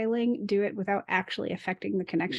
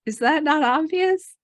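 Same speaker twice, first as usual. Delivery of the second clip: louder, higher mock exasperation; mock incredulous, as if it were obvious; exasperated retort; 8 semitones higher, 5 dB louder than usual. Host retelling her reaction to the mix-up, louder and higher. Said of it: "And I'm like".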